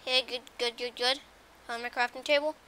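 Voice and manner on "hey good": ordinary delivery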